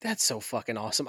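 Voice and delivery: normal talking voice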